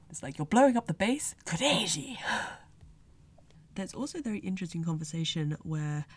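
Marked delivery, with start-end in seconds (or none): 0.4-2.2 put-on high, loud startled outburst with a Japanese-accented kureji; shocked gut reaction. Fan acting out Sally hearing the base plan, startled shout. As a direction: blurt it out in disbelief, pitch leaping. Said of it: "such a Sally Poe gut reaction".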